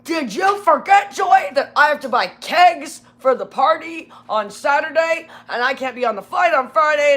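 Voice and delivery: mock angry yell. Supervisor yelling at Joy about buying kegs, hammed-up shout. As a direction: yell it like an indignant boss. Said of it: "he's, like, yelling at me".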